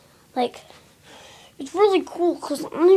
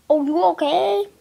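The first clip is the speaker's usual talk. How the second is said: normal talking voice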